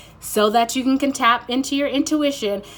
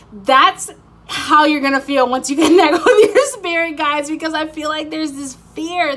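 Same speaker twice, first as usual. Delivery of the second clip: laughing; 7 semitones higher, 9 dB louder than usual. Real, not acted. Laughing excitedly at viewers.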